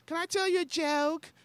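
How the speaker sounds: very high mock-drunk woman's voice; high, woozy drunk lady. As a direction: imitate a tipsy fan in falsetto. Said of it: "I'm like, she's drunk".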